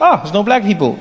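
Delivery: easy, untroubled noticing; the good way. Acted. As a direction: sigh it out as a pleasant observation. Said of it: "the good way is when you get there".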